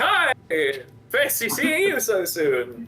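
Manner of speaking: high bright greeting, slow; cheery enchanter. Acted. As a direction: welcome them sweetly, a bit teasing. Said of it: "who welcomes you back in like".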